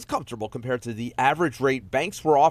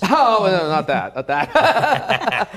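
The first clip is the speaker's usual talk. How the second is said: louder, laughing through the words; 6 semitones higher, 7 dB louder, much slower than usual. Real, not acted. Host teased about his singing by a co-anchor, real laughter. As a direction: laugh off the teasing, slowly drawn out.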